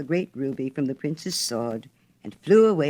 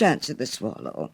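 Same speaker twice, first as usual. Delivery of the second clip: ordinary delivery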